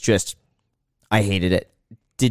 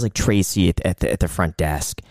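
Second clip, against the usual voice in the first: ordinary talk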